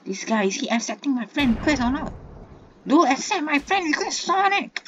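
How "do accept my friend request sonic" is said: loud high plea; begging shout. Real, not acted. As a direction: yell the plea.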